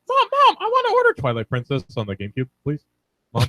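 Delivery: put-on very high whiny kid begging; childish high squeak. Teen mocking his childhood voice begging mom for a game. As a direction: plead up high like a little boy. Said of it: "I was like".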